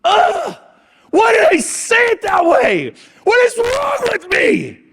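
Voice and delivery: high, loud shout of self-reproach, slow at the end; frustrated self-scolding. Retold or imitated. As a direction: yell at yourself for fumbling the words.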